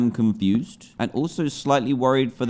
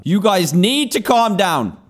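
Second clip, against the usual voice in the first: "calm down" shouted higher and louder, drawn out; exasperated shout; 6 semitones higher, 4 dB louder, slower than usual. Real, not acted. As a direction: yell at the rowdy villagers to settle. Shouting at villagers.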